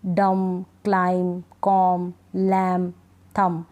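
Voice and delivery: डम, क्लाइम, कॉम, लैम, थम each ending on M; silent B. Shown as a demonstration. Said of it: "बी को हम नहीं पढ़ेंगे".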